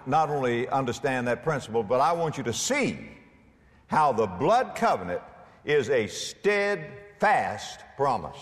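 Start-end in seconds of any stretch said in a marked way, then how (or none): 6.1-7.8 "steadfast" stretched slowly, syllable by syllable; stress on steadfast. Real, not acted. Preacher defining the covenant promise, emphasis on "steadfast". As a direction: lean hard and slow on "steadfast". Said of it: "Emphasis on the word steadfast".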